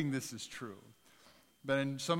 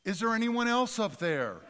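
laughing punchline; 8 semitones higher, 8 dB louder than usual. Retold or imitated. Punchline of cliff joke, laughing.

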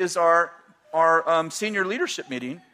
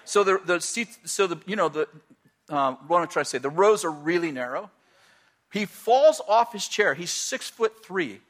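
ordinary talk, nothing marked

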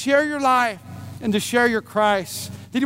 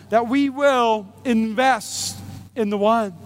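slow, deliberate emphasis; preacher's emphasis; slow emphatic; slower than usual. Acted. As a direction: drive the point home word by word. Preacher stresses key line.

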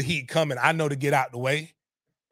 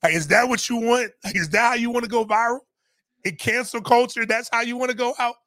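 mock scolding; 9 semitones higher, 3 dB louder than usual. Scolding people blocking the kid.

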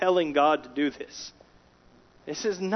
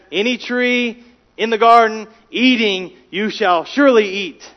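loud, with SURELY hit hard; ringing pulpit emphasis; emphatic reading; 6 semitones higher, 9 dB louder than usual. Shown as a demonstration. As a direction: proclaim it, leaning on SURELY. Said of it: "So you hear that?".

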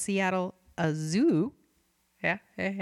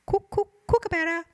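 high, hooting kookaburra call on "Cook, cook, kookaburra"; 9 semitones higher, 4 dB louder than usual. Retold or imitated. Host mimics the kookaburra call for Chris Pine, high and hooting. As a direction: chant "Cook, cook, kookaburra" like the bird laughing.

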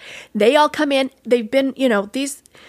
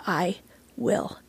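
quiet, word-by-word resolve; solemn promise with pauses between words; determined vow; 2 semitones lower, 8 dB quieter, much slower than usual. Retold or imitated. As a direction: drop softer and space out each word. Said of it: "I looked them in the eye and I said".

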